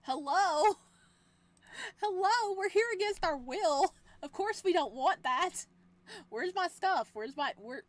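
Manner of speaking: high, loud incredulous rant; outraged protest. Real, not acted. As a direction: protest in disbelief at the captor. Captive protests, breaks character.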